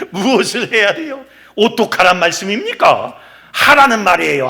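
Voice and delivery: ordinary delivery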